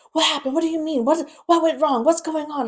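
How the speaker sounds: re-enacted panicked questioning, high and loud; frantic. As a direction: pelt out questions like a panicked relative. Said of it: "Just, like, frantic".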